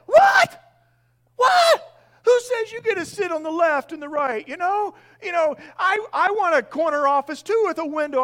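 high, loud jealous outcry; indignant disciples. Retold or imitated. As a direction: shout it like a colleague who missed the promotion.

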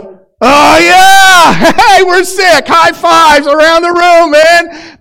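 high, loud, whooping and laughing; rowdy celebration. Retold or imitated. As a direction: cheer like the joke finally landed. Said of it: "they go like".

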